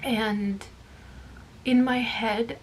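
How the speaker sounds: ordinary delivery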